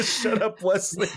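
mother snapping at her son, half-laughing; mom fires back. Retold or imitated. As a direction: fire it back at him with a laugh. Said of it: "she fires back with the".

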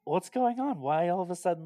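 whiny, baffled outburst at his dad; frustrated kid. Retold or imitated. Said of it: "you can feel his frustration".

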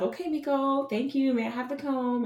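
high, sing-song gentle request; sing-songy. Retold or imitated. Mom asking her son for the comb, high and sing-songy. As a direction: sweetly ask for the comb, way up high. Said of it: "I was very sing-songy".

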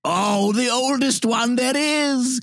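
put-on old country accent. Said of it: "the strange accents from some ancient".

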